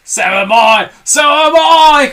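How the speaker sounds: building from loud to high, slow shout; Mr Twit crying out. A narrator's reading. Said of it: "cried Mister Twit".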